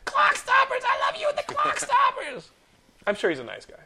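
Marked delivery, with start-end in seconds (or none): 0.0-2.5 very high, loud, slow fanboy scream; mock fan shout. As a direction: scream his movies like a rabid fan. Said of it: "I should have just been like".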